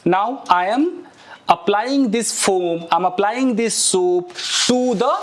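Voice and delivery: slow, careful English model sentence. Shown as a demonstration. Teacher modeling an English phrase slowly for students. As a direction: spell it out slowly for learners. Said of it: "demonstrating while washing".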